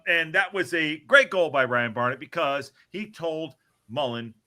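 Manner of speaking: usual speaking voice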